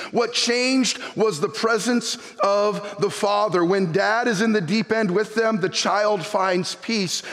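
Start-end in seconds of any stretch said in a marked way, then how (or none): none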